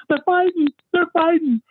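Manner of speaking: high, alarmed imitation of a wife; panicking Gail. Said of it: "she's, she's panicking".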